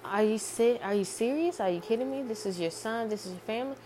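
mock-incredulous family appeal; retold disbelief. Shocked mother and sister protest to dad.

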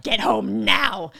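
loud, drawn-out command; urgent order. Retold or imitated. Labouring woman ordering her partner home, urgent order. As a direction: stretch each word into an insistent demand.

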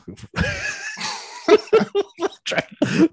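helpless high-pitched giggle; laughing at own failed attempt, high giggle; high squeal of a laugh. Real, not acted. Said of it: "A Scottish accent eludes me".

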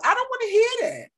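emphatic refusal. Real, not acted. Repeated refusal, higher.

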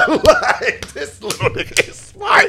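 high-pitched laugh running into the words. Real, not acted. Laughing at his own story.

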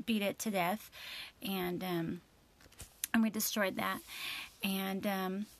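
usual speaking voice

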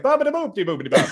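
high, quick mock-Italian babble; cartoonish fake Italian patter, laughing; Family Guy Italian gibberish. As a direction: parody Italian with bouncy nonsense syllables. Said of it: "that fucking Family Guy sketch".

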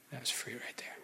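whispered aside. Real, not acted. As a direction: drop to a whispered aside to the crowd.

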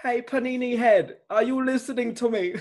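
high attempted British chef scold; Gordon Ramsay. Host retrying the chef impression after criticism, high mock scolding. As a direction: pitch it up, a lighter go at the chef. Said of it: "I can't do the British accent".

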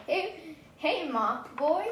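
put-on teasing kid voice. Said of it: "I'm like".

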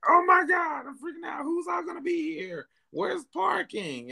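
mock-panicked rant, high and rushed; panicked freak-out. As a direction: spiral into party panic, pitch shooting up. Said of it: "I'm just like".

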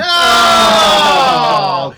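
loud, high horrified oh; disgusted cry. Real, not acted. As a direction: recoil at the gross reveal. Reacting to disgusting punchline.

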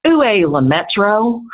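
put-on slow Southern-accented French. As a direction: drawl the French like a Georgia teacher. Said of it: "the way you say that is".

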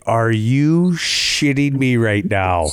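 slow, drawn-out disbelief. Retold or imitated. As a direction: stretch every word in shock. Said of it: "I was like".

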